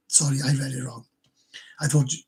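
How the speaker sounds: ordinary talk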